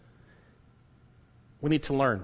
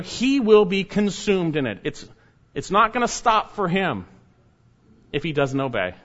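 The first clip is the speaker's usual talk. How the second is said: ordinary talk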